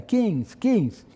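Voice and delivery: high-pitched mock call; imitated prisoner yelling. Exhausted prisoners calling a truce, imitated high yell. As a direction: cry it out high like a kids' time-out. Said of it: "the prisoners yelling".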